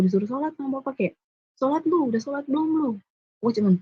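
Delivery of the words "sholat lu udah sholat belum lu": high, demanding mock father; father demanding she prays, high and bossy. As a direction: push her to pray, imitated bossy dad. Father ordering her to pray.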